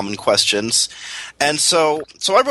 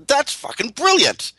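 ordinary talk, nothing marked

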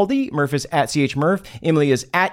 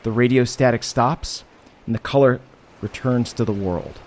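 eerie narration; 4 semitones lower than usual. Acted. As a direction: set a creepy scene, unhurried. Eerie scene setting, lower and slower.

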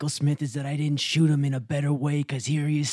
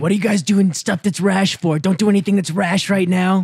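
loud scolding; 4 semitones higher, 7 dB louder than usual. Acted. Mock warning during armed standoff.

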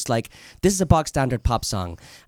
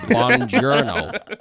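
hammed-up Italian greeting, much higher and slow, laughing; mock Italian, stretched out with a laugh; comic fake Italian accent, drawn out; bad Italian accent; 10 semitones higher, 4 dB louder, much slower than usual. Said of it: "as good an Italian accent as".

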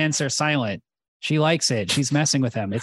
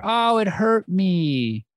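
mock hurt; 3 semitones higher, much slower than usual. Mock-hurt complaint continues.

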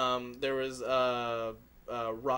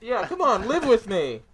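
creepy mock invitation; 6 semitones higher, 8 dB louder than usual. Teasing in mask.

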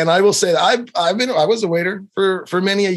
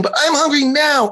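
put-on much higher whiny little girl; high bratty child complaint; 10 semitones higher than usual. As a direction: whine it like a hungry toddler. Child interrupts dad.

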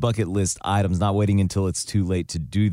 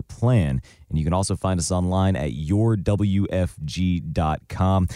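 ordinary talk, nothing marked

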